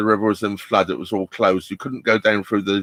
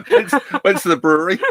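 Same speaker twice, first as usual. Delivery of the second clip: laughing through the words, pitched higher; 10 semitones higher than usual. Real, not acted. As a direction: say it through giggles. Both laugh about leaving for pub.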